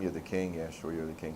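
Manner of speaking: low, quiet dismissive scoff; mocking disbelief. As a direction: brush him off with understated sarcasm. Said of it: "they're laughing at him".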